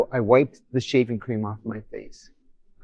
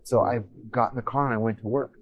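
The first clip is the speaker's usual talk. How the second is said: ordinary talk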